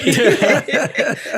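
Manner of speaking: loud real burst of laughter; hearty laugh. Real, not acted. Laughing at own joke.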